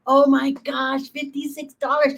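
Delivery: high-pitched, amazed reaction. Real, not acted. Reacting to clip's donation figure.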